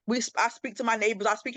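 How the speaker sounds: ordinary talk, nothing marked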